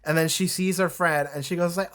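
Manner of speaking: ordinary talk, nothing marked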